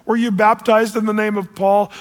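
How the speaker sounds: high, incredulous rebuke; exasperated Paul. Retold or imitated. Preacher voices Paul confronting the factions, exasperated. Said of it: "and Paul says".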